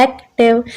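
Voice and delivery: "एक्टिव" in two parts with a gap after एक; split into syllables. Shown as a demonstration. Teacher contrasting it with van, "एक्टिव" split in two. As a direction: separate एक from टिव in "एक्टिव". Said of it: "एक्टिव में दो parts बन रहे".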